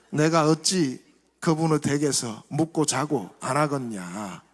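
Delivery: Jeolla dialect reading. Acted. Pastor reading Psalm 23 to the church in Jeolla dialect. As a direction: read the psalm like a Jeolla grandpa. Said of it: "전라도".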